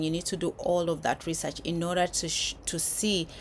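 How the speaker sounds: ordinary delivery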